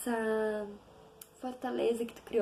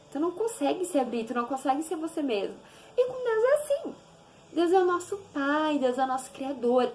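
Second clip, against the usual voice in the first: ordinary delivery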